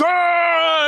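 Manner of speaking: an excited crowd's "Ah!" in mock; crowd cheer. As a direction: mimic the whole crowd going wild. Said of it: "And the audience".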